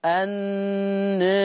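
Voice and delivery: النار drawn out very slowly, alif leaning toward e and the راء thin; softened ra. Shown as a demonstration. Teacher repeats النار to show the softened ra. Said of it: "رققت الراء".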